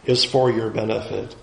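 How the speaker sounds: low, soft voice of divine assurance; God speaking. Acted. God assuring the people of the rules' benefit, low and soft. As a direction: say it low and quietly, settling at the end. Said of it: "And God is saying".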